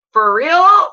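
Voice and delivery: high, loud mock disbelief; incredulous. Wife to husband who won't trust her, loud disbelief. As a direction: say it like he's got to be kidding.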